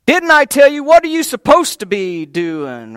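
loud, sharp high scolding; hollering at you. Retold or imitated. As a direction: yell it like a fed-up sister in the kitchen. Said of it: "before she has to holler at you".

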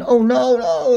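high imitating worried elves; a hammed-up oh no; mock alarmed cry. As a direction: elves crying out as Santa falls. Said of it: "elves were crying out loud".

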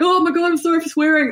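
high-pitched gushing apology, retold; flustered apology. Competition winner apologising to the station for swearing, flustered.

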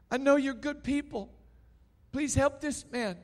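high, loud pleading at the frozen crowd; pleading outcry. Retold or imitated. Gomez calling on his frozen friends to help, high urgent plea.

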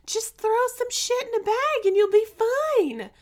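very high mock husband voice; high, dismissive caricature of a spouse; imitating husband. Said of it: "He's like".

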